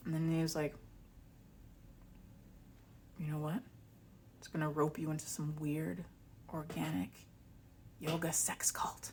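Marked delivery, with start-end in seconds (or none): 3.2-9.1 conspiratorial whisper. Retold or imitated. Ex-partner warning her about a cult, in a whisper. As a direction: whisper it like a dark secret.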